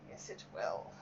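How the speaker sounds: dry whispered self-reply; whispered aside. Real, not acted. Streamer answering herself in a sarcastic whisper. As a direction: whisper it to yourself, wry.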